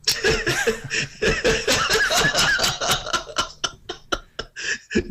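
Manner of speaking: giggling through a line; high laughter. Real, not acted. Laughing at the joke.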